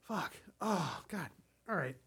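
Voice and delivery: ordinary talk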